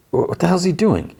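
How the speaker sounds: high-pitched incredulous question; mock-alarmed query; retold bafflement. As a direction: act out baffled disbelief, voice jumping up. Retold baffled question about passenger.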